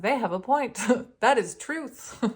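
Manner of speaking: high, loud, laughing admission; laughing concession. Retold or imitated. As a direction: concede it emphatically through laughter. Retold amused agreement with billboards.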